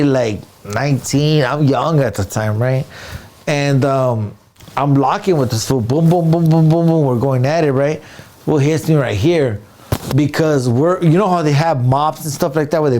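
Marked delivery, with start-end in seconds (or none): 5.8-7.0 rapid run of booms; imitated punch booms. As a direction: pound out the blows vocally. Imitating blows in the fight.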